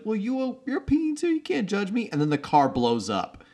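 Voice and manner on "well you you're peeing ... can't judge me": very high-pitched defensive retort; mock indignation. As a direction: imitate someone squeakily defending himself. Acting indignant retort from film.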